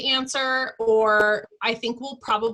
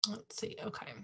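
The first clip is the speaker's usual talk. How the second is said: whispering; 16 dB quieter than usual. Real, not acted. Aside while checking her screen.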